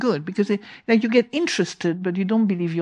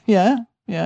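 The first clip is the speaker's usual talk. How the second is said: normal talking voice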